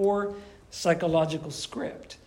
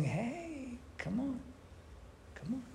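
much softer, higher coaxing "come on"; luring temptress; 7 semitones higher, 14 dB quieter than usual. Retold or imitated. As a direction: lure someone in quietly, teasing. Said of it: "Luring and being a temptress".

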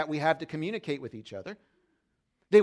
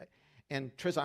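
ordinary talk, nothing marked

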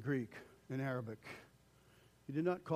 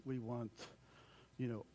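usual speaking voice

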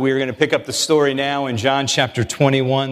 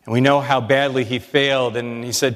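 usual speaking voice

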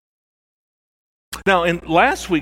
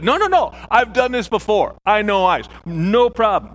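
overconfident; 6 semitones higher, 4 dB louder than usual. Retold or imitated. Frank insisting on ice fishing.

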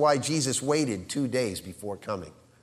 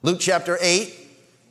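ordinary delivery

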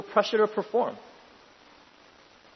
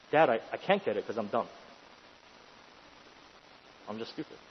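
low, quiet admission, sinking to barely audible; imitating a child giving up, subdued; defeated child; 6 semitones lower, 8 dB quieter than usual. Child confessing to dad he can't get it, defeated.

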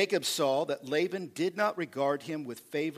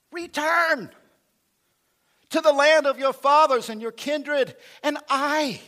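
booming, much higher and louder call; voice of God; 10 semitones higher, 9 dB louder than usual. Pretend. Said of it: "You can hear God going".

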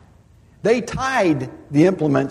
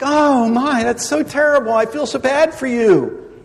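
mock compassion; 8 semitones higher, 4 dB louder than usual. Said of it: "we try to be all compassionate".

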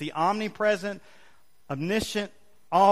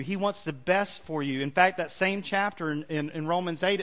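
ordinary talk

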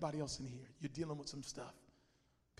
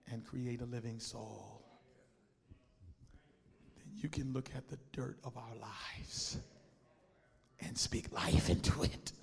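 whispered prayer; 3 semitones lower than usual. Real, not acted. Hushed prayer.

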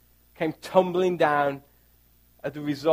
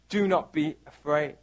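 softer, lower, tender close; gentle reassurance; 4 semitones lower, 6 dB quieter than usual. Shown as a demonstration. Preacher comforting congregation, quiet tender. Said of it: "Do not be afraid (repeated)".

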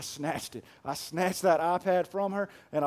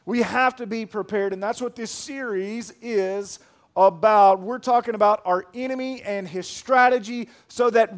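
loud, driving sermon delivery; preaching at full force; 5 semitones higher, 7 dB louder than usual. Acted. Pastor urging the church to stand firm, loud preaching. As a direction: build the urgency line by line.

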